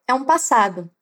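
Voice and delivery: ordinary talk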